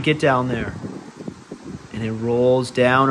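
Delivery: ordinary delivery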